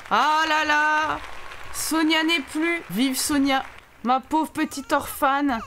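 hammed-up pity for an orphan; mock mourning. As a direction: draw out the eulogy theatrically. Hammed-up eulogy for the Sim.

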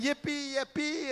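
high, delighted cheer; high childish whoop of joy; gleeful yippee. Retold or imitated. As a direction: squeal it like a thrilled kid. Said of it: "He's saying".

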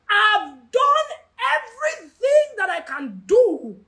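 acted despairing plea; desperate plea. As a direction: drag it out in exhausted frustration. Acts out a desperate believer.

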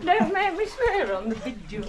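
ordinary talk

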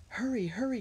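high, urgent call to the others; urgent cry. A narrator's reading. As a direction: hurry them along, high and pressing. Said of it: "cried Jessie".

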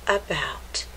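hushed story whisper. Acted. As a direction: lean in and whisper.

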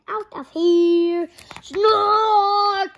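loud, high yelling that builds to a cry; panicked shout. Acted. Baby bird caught by the snort, panicked loud yelling. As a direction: scream to get away from the machine.

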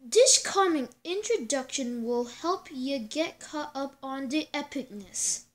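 ordinary talk, nothing marked

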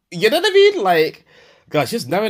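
real exasperation, high and loud; exasperated outburst. Real, not acted. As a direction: burst out in disbelief. Exasperated outburst about the lie.

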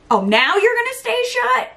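indignant. Real, not acted. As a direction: scold the lid that shuts now. Scolding the compact lid.

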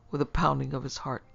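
usual speaking voice

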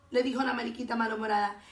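usual speaking voice

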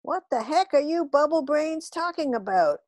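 cat scolds the aliens, squeaky and shrill. A narrator's reading. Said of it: "in her usual shrill, squeaky voice".

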